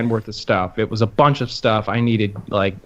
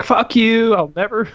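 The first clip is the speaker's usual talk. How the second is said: mock angry tweet; 10 semitones higher than usual. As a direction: spit it out in hammed-up fury. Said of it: "I was like".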